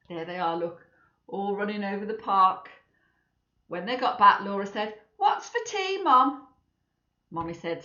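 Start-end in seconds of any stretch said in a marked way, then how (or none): 5.2-6.5 high, put-on child voice; a hungry child's question. Laura asking her mum, high voiced.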